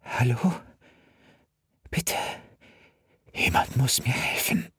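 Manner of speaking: high, whispered plea for help; a child begging in a whisper; scared girl. A narrator's reading. Frightened girl begging the dispatcher for help.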